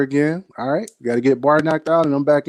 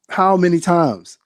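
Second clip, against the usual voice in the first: slow insistent repeat; 6 semitones higher, slower than usual. Retold or imitated. Repeats the question, slower.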